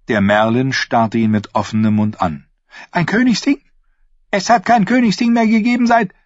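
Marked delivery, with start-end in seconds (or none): 2.9-6.1 much higher, fast disbelieving voice; astonished lord. Acted. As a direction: blurt it out in disbelief. Lord stares open-mouthed, disbelieving. (Lord ungläubig und erstaunt)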